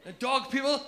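usual speaking voice

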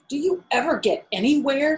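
high, loud taunting question; mocking hare. A narrator's reading. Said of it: "he asked with a mocking laugh".

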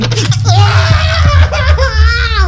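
long, loud high-pitched scream; terrified scream. Real, not acted. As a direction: scream wildly at a jump scare. Jump scare hits.

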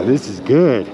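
ordinary talk

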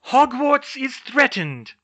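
slow, loud, high alarm; shouted. Acted. McGonagall rousing the statues to defend, shouted slowly. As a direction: proclaim it to the whole castle. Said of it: "shouted Professor McGonagall".

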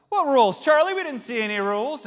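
indignant grandpa. Retold or imitated. Grandpa protesting to Willy Wonka, indignant and loud. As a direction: demand it like a wronged old man.

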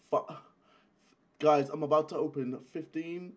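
ordinary talk, nothing marked

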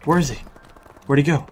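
normal talking voice

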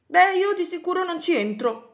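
high, loud refusal; digging heels in. A narrator's reading. Cosmo refuses to enter the cave, digging heels in. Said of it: "si impuntò Cosmo".

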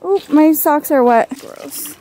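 ordinary delivery

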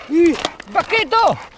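high, loud, slow call of il bacchetto; excited shout. Real, not acted. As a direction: cry out il bacchetto with a stretched bacchetto. Spots the stick, calls dog. (grido eccitato: il bacchetto)

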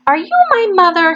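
put-on high small voice of a lost baby bird. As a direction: ask it as a tiny hopeful chick. Baby bird asks the cow.

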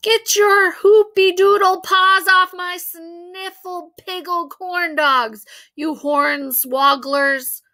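slow, high, loud silly-word rant; guard your tray and bellow nonsense insults; angry kid yelling. A narrator's reading. Michael defending his tray.

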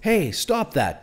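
high, drawn-out protest; mildly shocked, slow call to stop; gasped. A narrator's reading. Dad to the woman shaking his son, understated gasp. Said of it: "Dad gasped".

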